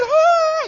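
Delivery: very high, loud shriek; hammed-up girly scream of surprise; girls' excited squeal. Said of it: "Some of you girls, you're like".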